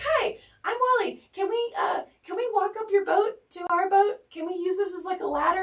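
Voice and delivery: very high, loud put-on voice; imitated chirpy cousin. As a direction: call over brightly, mimicking the cousin's request. Imitates cousin asking the stranger.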